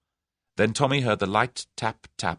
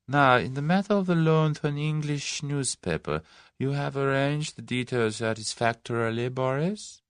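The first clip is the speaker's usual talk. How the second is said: silky leader, higher voice; 8 semitones higher than usual. A narrator's reading. Number one continues.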